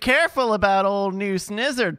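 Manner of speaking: put-on hammy old-timer New England warning; mock New England accent. [name] as New England local warning visitors off the place. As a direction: warn them off like a crusty New England local.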